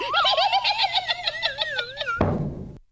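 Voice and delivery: high triumphant cackle; wicked gloating cackle; evil witch cackle. Acted. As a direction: cackle over the blinded prince. Witch triumphs over prince.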